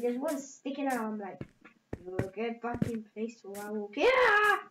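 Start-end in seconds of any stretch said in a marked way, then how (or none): 2.0-4.6 playful angry threat rising to a yell; mock mad. Gamer acting mad at a block sticking out, mock-angry yell. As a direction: threaten it crossly, then burst into a yell. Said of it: "so mad".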